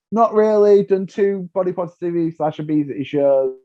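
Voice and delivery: high, dismissive no thanks; dismissive refusal. Retold or imitated. Turning down the TV producers, a flat high no. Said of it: "I was like".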